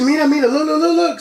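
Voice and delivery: high, insistent imitation of grandma; urgent alarm. Grandma urgently showing grandson the intruder, imitated high alarm.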